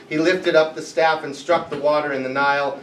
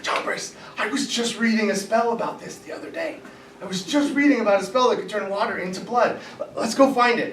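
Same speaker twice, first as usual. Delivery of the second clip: high, fast, eager chatter; a magician bursting to try a spell; excited magician; 7 semitones higher, 3 dB quieter than usual. Acted. Magician telling his partner about a spell, excitedly. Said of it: "He starts getting excited".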